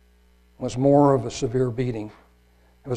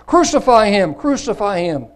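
loud, higher demanding cry; crowd crying out; 7 semitones higher, 7 dB louder than usual. Acted. Chief priests crying out to Pilate, loud demanding shout. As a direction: shout like an angry mob.

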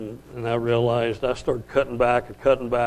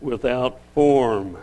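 "without form" spoken very slowly and weighed; stress on without form; much slower than usual. Shown as a demonstration. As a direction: draw out "without form" word by word. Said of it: "emphasis in this verse should be on the word".

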